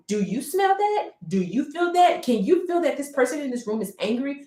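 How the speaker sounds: high, uneasy voiced memory of her own words; anxious inner voice. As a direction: voice the younger self pleading to be understood. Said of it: "Like, like".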